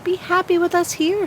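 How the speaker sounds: gentle air and sunlight. Acted. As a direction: soothe the restless tree. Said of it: "said the air and the sunlight".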